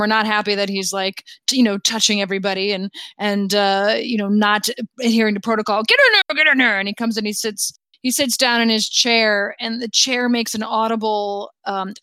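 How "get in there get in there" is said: high, hurried royal bluster; jovial king urging. Acted. King ushers the crew over, breaking protocol, excited and hurried. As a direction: hustle them along excitedly.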